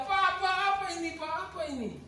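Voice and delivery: put-on high, fast, frantic cry in a boy's voice; child screaming. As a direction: blurt it out in panic like a scared child. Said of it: "saya bayangkan anaknya teriak-teriak".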